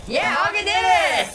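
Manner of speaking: loud high cheer; excited cheer. Real, not acted. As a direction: cheer it out, thrilled. Cheering a goal.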